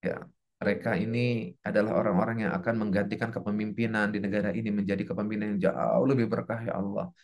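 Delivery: low, hushed supplication; subdued, low blessing; teacher's prayer. Shown as a demonstration. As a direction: pray earnestly under your breath for your pupils. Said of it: "I pray for you".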